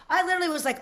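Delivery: normal talking voice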